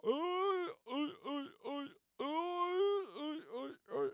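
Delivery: very high falsetto whooping "oi"; imitated high-pitched war cries; desert warrior cries. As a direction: yelp like wailing desert raiders. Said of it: "desert warriors came and they go".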